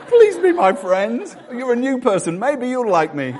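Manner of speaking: high, slow pleading plea for friendship; needy lonely voice. Pretend. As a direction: beg like a lonely newcomer. Pastor voicing a lonely visitor.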